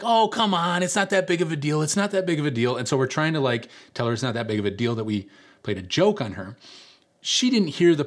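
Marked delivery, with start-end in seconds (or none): 0.0-2.8 higher-pitched mock coaxing, easing down; placating. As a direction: imitate friends brushing it off. Friends placate the upset girl.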